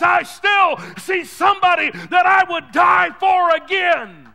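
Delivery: rising, shouted pulpit preaching; preaching at full force. Acted. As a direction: build the sermon to a roar. Preaching to congregation.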